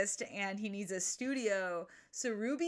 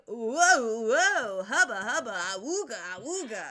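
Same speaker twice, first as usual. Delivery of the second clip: playful imitation of a guy ogling a pretty girl; lusty catcall; 6 dB louder than usual. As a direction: ham up a cartoon wolf whistle in words. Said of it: "so Nate's like".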